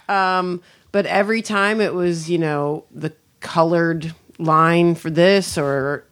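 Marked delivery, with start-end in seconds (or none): none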